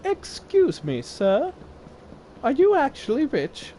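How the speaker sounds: high, prim mock voice of a grown woman; mocking woman's voice. Grown woman questioning a boy, high mock voice. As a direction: put on a high snooty lady voice. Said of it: "she comes up to me and she goes".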